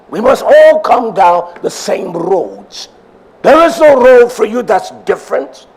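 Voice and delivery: rising pulpit fervor; preaching. Acted. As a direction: drive the point home to the congregation. Preacher building to a climax.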